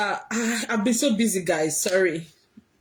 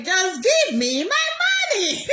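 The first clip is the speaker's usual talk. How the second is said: higher, slow, whiny mocking of her demand; mocking Priscilla; 7 semitones higher, slower than usual. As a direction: drag out the demand like a spoilt child. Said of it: "when no mama make her".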